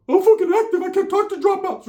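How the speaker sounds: squeaky high cartoon-mouse cousin; Mickey Mouse voice. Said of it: "put the Mickey Mouse to it".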